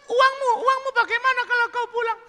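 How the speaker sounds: loud, very high falsetto; woman calling out. Acted. Woman calling after departing customer, loud falsetto.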